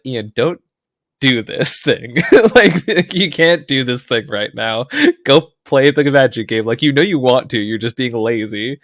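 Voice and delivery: mildly hammed-up stern self-talk; scolding inner voice. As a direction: scold yourself like a strict coach. Scolding himself in his head.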